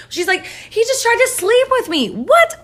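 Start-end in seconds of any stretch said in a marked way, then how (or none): 0.5-2.0 very high-pitched accusing wail; hammed-up victim's complaint, pitched way up; mock outrage. Wife falsely accuses Joseph to her husband, mock outraged. 2.1-2.6 very high, loud yelp of disbelief; mock shock. Husband hears his wife's accusation, shocked.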